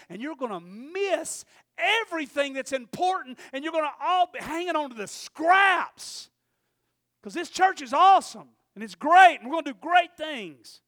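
rising to a loud, high preaching cry; fiery preaching. Acted. Pastor warning the church, building to a preaching shout.

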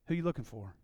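quiet, low and unafraid; low calm challenge to a hundred men; calm, low question. Retold or imitated. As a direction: ask it softly, no fear at all. Jesus addressing the mob.

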